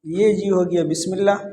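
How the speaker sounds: usual speaking voice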